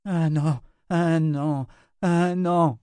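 high, insistent repetition; entranced high-voiced babble; deranged murmuring. A narrator's reading. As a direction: repeat it like a delirious woman, pitched high. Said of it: "Ulissia murmura".

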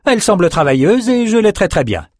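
high noble lady reassuring; Elsa. A narrator's reading. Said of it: "dit Elsa".

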